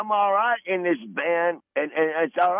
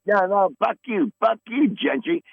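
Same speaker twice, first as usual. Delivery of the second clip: angry shout; 7 semitones higher than usual. Real, not acted. Angry retort to being called old.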